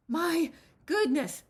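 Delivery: breathless, drawn-out, high and loud; panting. A narrator's reading. Granny panting to Jigsaw, out of breath. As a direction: gasp it out after the stairs. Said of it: "panted Granny".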